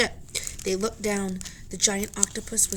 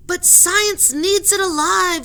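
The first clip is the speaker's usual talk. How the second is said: high, loud, slow insistence; insistent argument; 10 semitones higher, 9 dB louder, slower than usual. Acted. Professor argues with the captain, high, loud and slow. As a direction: argue for science. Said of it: "said the professor".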